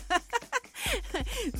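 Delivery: soft high burst of laughter. Real, not acted. Host laughs at a listener's message.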